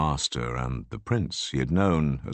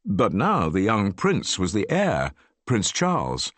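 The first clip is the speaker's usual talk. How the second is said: plain reading voice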